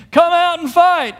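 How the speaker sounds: high, loud taunting call; shouted challenge. Acted. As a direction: bellow it out like a battle challenge. Mimics giant's challenge to hiding army.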